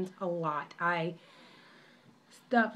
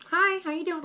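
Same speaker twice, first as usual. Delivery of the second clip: high, casual ghostly greeting, a bit louder; spirit's friendly hi; 9 semitones higher, 5 dB louder than usual. Retold or imitated. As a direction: chirp the ghost's hello up high. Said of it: "popped in front".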